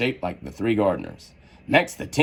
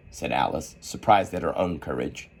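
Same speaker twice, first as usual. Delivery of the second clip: plain reading voice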